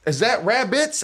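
high, astonished retold question; amazed question. Man retelling his surprise at the rabbits hopping by, amazed.

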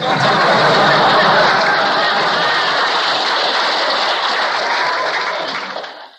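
long, loud real laughter. Real, not acted. Teacher cracking up at his cosmic joke, long loud laugh. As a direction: let a big laugh roll on.

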